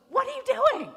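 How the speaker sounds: very high, loud outraged demand; hammed-up, piercing disbelief; indignant mock shriek. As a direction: imitate an affronted woman shrieking. Acts suspicious woman at coffee counter.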